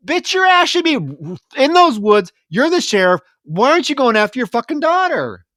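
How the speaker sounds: high, loud indignant rant; real outrage. Real, not acted. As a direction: vent genuine disbelief at the useless sheriff. Outraged at the sheriff's inaction.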